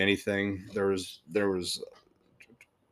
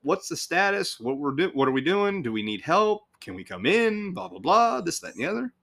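higher, louder call through a closed door; calling out higher and louder to the family inside; retold yell at the door; 8 semitones higher, 3 dB louder than usual. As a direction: shout at the door to get an answer. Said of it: "at least yell on the door".

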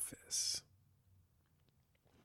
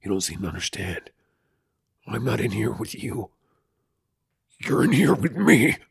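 Rorschach impression. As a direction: quote the Watchmen line with building menace. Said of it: "I loved him in Watchmen when he was like".

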